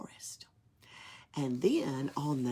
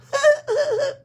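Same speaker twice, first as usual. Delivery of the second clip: shrill, much higher giggle; high ornament giggle; 11 dB louder than usual. Acted. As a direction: giggle like a tiny mischievous ornament. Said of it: "Two voices giggled".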